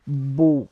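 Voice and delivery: beau said bo, the final consonants silent; French beau. Shown as a demonstration. Teacher modelling French for students, beau said bo. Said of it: "in French we say".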